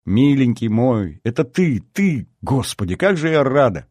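put-on high, swooping girlish delight; breathless-with-happiness exclamations; overjoyed. A narrator's reading. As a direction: gush with joy, stretching the endearment. Girl laughing and crying with joy.

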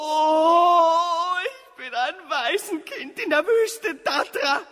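high, loud sobbing lament; hammed-up wailing. Orphaned son over his mother, overblown sobbing. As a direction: wail like a melodramatic orphan.

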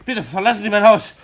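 high, loud and fast, ordering them out; indignant outrage. Acted. Glienicke ordering the gentlemen from his house, outraged. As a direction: throw them out of your house.

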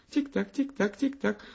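high, light tick-tock patter; little feet ticking down the stairs; ticking chant. A narrator's reading. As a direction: tap it out like tiny shoes on stairs. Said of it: "снова раздалось".

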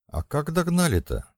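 usual speaking voice

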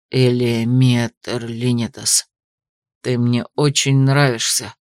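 low, slow male voice; young man's voice. A narrator's reading. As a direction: deliver the confession slow and low. Classmate's sudden confession.

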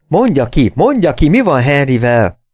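magas hangú, izgatott unszolás; követeld egyre türelmetlenebbül; sürgető követelés. A narrator's reading. Lány követeli a választ. (izgatott, magas hangú sürgetés)

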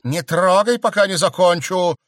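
high, loud order; commanding shout. A narrator's reading. Stranger holds back the count, loud sharp order. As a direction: stop him sharply.

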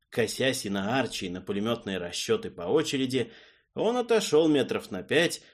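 plain reading voice

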